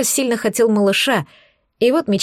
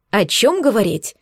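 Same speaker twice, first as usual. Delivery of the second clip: usual speaking voice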